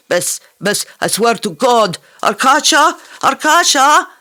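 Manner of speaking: high, loud call downstairs. Acted. Fareed calling Arkasha up from the basement, loud call. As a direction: shout his name down to the basement. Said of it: "Fareed called to the basement".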